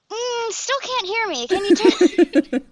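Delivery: high mock-fussy complaint; pain-in-the-ass singer. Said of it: "I would be like".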